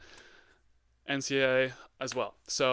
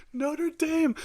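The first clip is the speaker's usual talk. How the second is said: mocking sing-song; 5 dB louder than usual. Real, not acted. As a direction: say "Notre Dame" very high and squeaky, in a taunt that falls away. Mocking the beaten team.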